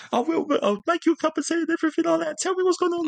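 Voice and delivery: put-on high, fast flustered old nan voice; high-pitched fussing nan, talking fast. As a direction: rattle it off like a panicking grandmother. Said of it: "She said".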